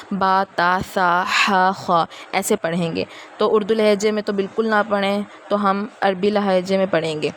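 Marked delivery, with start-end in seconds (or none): 0.1-3.0 با تا سا ہا خا with the open Arabic a; long a on each letter, Arabic style; Arabic letter names. Shown as a demonstration. Teacher demonstrating Arabic letter sounds to students. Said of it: "جو عربی لہجہ یہ ہے کہ".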